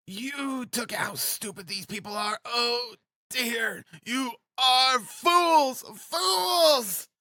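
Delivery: high, scornful cry to the whole room. A narrator's reading. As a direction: build to a drawn-out, very high cry on the last words. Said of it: "she cried, addressing the whole room".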